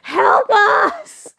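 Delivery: high, loud crying plea; mimicking mum crying out to the fishermen; imitated shout for help. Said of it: "Mum's like".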